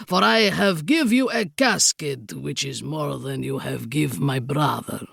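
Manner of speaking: put-on low villainous Count with Bragislanian accent; thick Bragislanian accent. A narrator's reading. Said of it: "in his thick Bragislanian accent".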